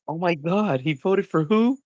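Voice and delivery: high-pitched scandalized gasp of disbelief; mock outrage. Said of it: "if you're that sensitive".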